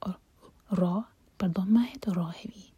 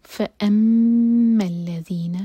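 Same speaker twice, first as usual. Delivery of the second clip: loud, held فَأَمَّا الَّذِينَ with ghunna on میم; tajweed recitation; 2 semitones higher, 8 dB louder than usual. Shown as a demonstration. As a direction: chant it with the نون and میم held. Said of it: "میم پر غنہ".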